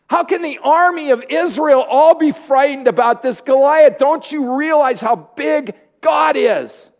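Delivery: indignant shout, slowing on the last question. Retold or imitated. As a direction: shout it at the cowering army. Young David outraged at frightened army.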